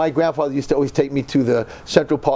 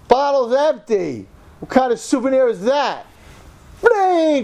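high, slow, outraged cry building to a loud "Bling!"; outraged disbelief; 11 semitones higher, 3 dB louder than usual. Retold or imitated. Host watching the bottle fly, outraged and high. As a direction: act the shock as the bottle smashes.

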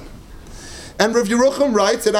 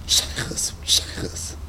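a hushed, breathy aside; whispered; 8 dB quieter, much slower than usual. Acted. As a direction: breathe the word like a secret. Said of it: "I don't know if he said shaychus".

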